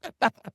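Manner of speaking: high-pitched laugh; burst of laughter. Real, not acted.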